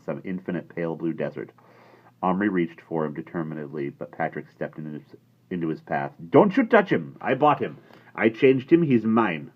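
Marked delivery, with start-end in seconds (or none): 6.3-9.5 high, loud possessive shout; angry boy. A narrator's reading. Patrick blocking Omri from the cowboy, furious. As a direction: shout to keep what's his. Boys quarrelling over cowboy.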